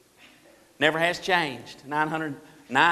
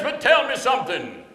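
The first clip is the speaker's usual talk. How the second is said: raised mock-preacher voice; hammed-up preacher questioning a child; preacher's voice; 6 semitones higher than usual. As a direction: lift it into a pulpit call. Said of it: "That's the way he talks".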